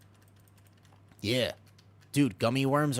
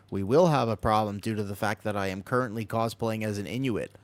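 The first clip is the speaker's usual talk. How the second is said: ordinary talk, nothing marked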